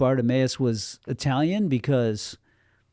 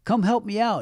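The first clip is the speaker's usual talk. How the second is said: mock calling out; 9 semitones higher than usual. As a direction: imitate a beggar's yell at restrained volume. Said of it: "to keep yelling out to Jesus".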